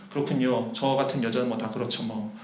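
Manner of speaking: low, soft self-deprecation; resigned. Acted. Woman answering Jesus' refusal before turning back, resigned. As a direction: accept the rejection quietly, as if giving up. Woman's imagined giving-up reply.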